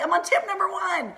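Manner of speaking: high-pitched, laughing excitement; fired up. Real, not acted. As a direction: exclaim it high, breaking into a laugh. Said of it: "I'm getting fired up already".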